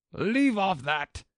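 high, drawn-out scream; loud, high royal screech. A narrator's reading. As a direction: scream it as an exasperated queen. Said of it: "screamed the queen".

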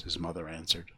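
ordinary delivery, nothing marked

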